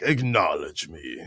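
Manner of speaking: catchphrase demo. Pretend.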